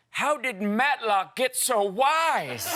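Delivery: put-on high, slow, quavery old man. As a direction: wobble it out like a confused grandpa. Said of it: "even more attracted with the old man voice".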